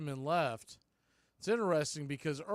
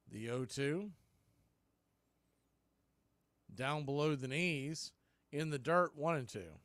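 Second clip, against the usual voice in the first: steady radio call, pitch by pitch; live play-by-play. Real, not acted. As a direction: call each pitch to the listeners.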